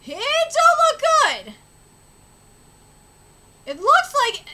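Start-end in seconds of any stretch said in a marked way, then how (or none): 0.1-1.5 worried. Real, not acted. Shen's fate looks bad.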